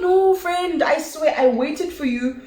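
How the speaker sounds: high-pitched imitation of a pleading friend; retold earnest protest; protesting innocence. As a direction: swear it high, defending yourself.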